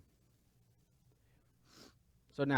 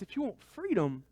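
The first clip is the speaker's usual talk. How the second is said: ordinary talk, nothing marked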